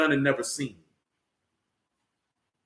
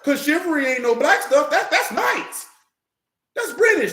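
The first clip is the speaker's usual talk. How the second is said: higher, laughing through the words; 7 semitones higher than usual. Real, not acted. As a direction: laugh it out while making the point. Laughing while mocking chivalry.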